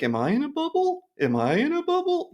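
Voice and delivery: very high, whiny mock question; mock her fretful doubt; high mocking impression of a worried YouTuber; mocking Grace Randolph. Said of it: "she was like".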